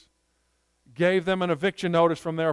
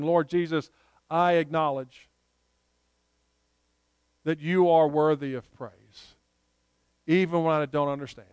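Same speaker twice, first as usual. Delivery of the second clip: modelled prayer. Shown as a demonstration.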